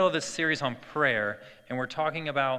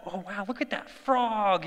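put-on drawn-out kid wonder in a raised pitch; delighted child; 5 semitones higher than usual. Little son spotting a frog, imitated slow delight. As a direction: imitate a wowed little boy, higher and slow. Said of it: "He's enjoying every moment".

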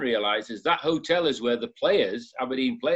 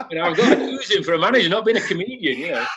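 higher and louder, still laughing through the words; 5 semitones higher, 5 dB louder than usual. Real, not acted. Telling the interview story to Mark, laughing through it.

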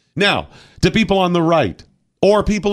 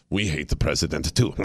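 muttered mock voice; 11 semitones lower, 6 dB quieter than usual. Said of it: "I probably shouldn't be that accent".

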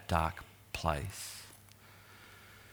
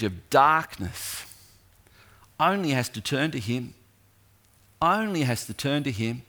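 higher, louder, building preacher's cry; full-force preaching; 4 semitones higher, 3 dB louder than usual. Real, not acted. Preacher urging the congregation to turn to him, impassioned. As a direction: rise up and plead with the church.

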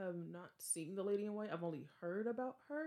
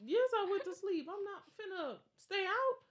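much higher, laughing self-defence; defensive; 13 semitones higher than usual. Real, not acted. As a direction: squeal the excuse, owning it. Laughing admission.

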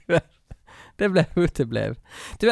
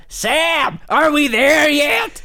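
high, slow mock raised voice; 12 semitones higher, much slower than usual. Host mimicking Sandra calling to Sam on the bus, slow and high. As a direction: call up to the driver, much higher. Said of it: "Hon höjer ju rösten där".

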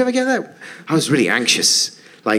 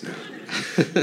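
ordinary delivery

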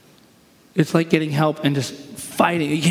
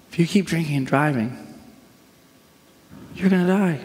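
pleading caution rather than menace; warning; 3 semitones higher than usual. Shown as a demonstration. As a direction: warn them of the consequence, lifting the last phrase. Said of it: "This is a warning".

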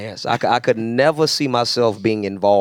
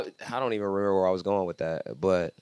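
trailing off; 5 semitones lower, 10 dB quieter than usual. Real, not acted. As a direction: voice fading out. Losing his train of thought.

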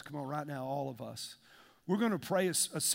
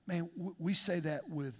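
normal talking voice

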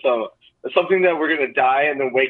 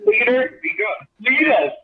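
mock reverent; 11 semitones higher than usual. Mock worship of the leader.

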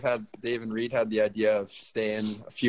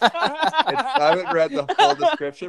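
laughing; 7 dB louder than usual. Real, not acted. Laughing while explaining the location.

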